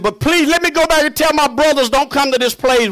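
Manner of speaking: a preacher's mock begging; pleading rich man. Rich man in hell begging Abraham for his brothers, lightly acted plea.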